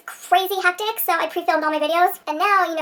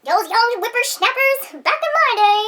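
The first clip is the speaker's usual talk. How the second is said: put-on slow, high cranky old-person voice; lightly voiced cranky elder; grumpy old-timer; 6 semitones higher, 3 dB louder, slower than usual. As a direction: grouse like a grandpa. Mock old-timer grumble.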